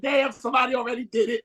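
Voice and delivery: high, fast playful exclaim; retold shout. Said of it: "y'all like".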